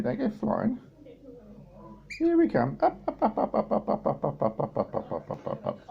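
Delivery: high chant sinking to a whisper. Real, not acted. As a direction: start high, fade the ups to nothing.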